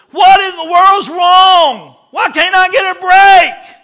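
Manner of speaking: high, very loud, drawn-out wail of protest; anguished shout. Acted. Preacher voices an orphaned boy waking up, anguished yell. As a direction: cry out at the world in exasperation. Acting out boy's despairing cry.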